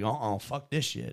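lightly Southern guy, refusing; mild mock Southern accent. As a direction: put on a bit of Southern twang. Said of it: "I don't know why he's Southern".

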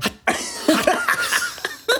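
very high squealing laugh; squeaky high laugh. Real, not acted. Podcaster reacting to cohost's joke, squealing laugh.

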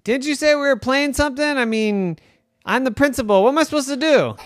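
brother shouting. Acted. Little brother shouts to sister, mock yell. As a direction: shout it from the next room.

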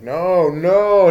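loud, high retold cry of alarm; acted-out panicked shout; frantic warning. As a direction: call out as if through a window to stop someone. Acting warning through a window.

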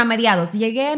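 ordinary delivery

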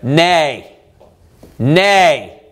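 low, measured refusal; stern. Acted. The judge answering those who plead, stern. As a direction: shut the plea down, low and final. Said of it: "Notice how stern he is".

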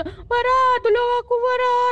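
high, loud frightened cry for help; terrified call for help; panicked plea. Retold or imitated. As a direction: beg your friend to save you. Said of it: "tiba-tiba dia macam".

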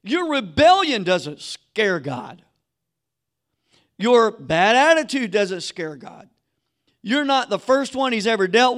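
sermon cadence, measured and firm; preaching. Acted. Preaching to the congregation.

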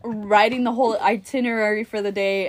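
ordinary talk, nothing marked

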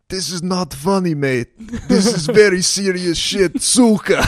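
high, loud, mock-angry scolding; angry Ukrainian. Ukrainian teammate scolding laughing soldiers, mock angry. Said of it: "he's like".

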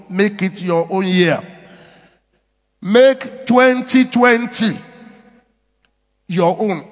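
ordinary delivery

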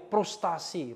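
"frustasi" said as "prustasi". Shown as a demonstration. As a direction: say "frustasi" with a P at the start. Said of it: "Frustasinya pakai P".

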